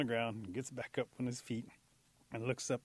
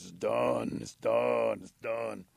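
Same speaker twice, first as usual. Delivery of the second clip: Bullfrog reporting; 6 dB louder, much slower than usual. A narrator's reading. Bullfrog tells Eagle the job is finished, loud bullfrog report. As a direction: punch out each short phrase like a frog.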